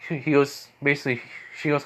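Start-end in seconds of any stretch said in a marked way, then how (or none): none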